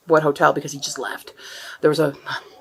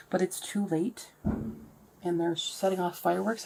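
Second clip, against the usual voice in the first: soft real whisper; 12 dB quieter than usual. Real, not acted. Vlogger near scared dogs at night, real whisper. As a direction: whisper it, much softer.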